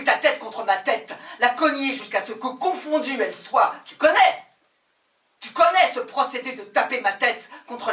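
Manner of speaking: furious shouting. Acted. Berating a self-pitying lover, a loud, furious, laughing rage. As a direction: hurl the insults, half laughing, all fury.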